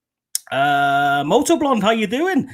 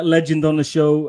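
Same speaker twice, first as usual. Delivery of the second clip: ordinary delivery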